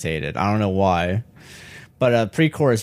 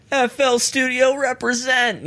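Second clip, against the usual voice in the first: high, slow hype shout-out; drawn-out radio tag voice; mock announcer shout-out; slower than usual. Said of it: "I wouldn't go like".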